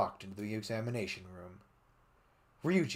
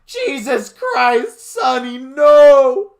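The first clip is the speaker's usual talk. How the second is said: yelled; 20 dB louder, much slower than usual. Acted. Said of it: "I yelled".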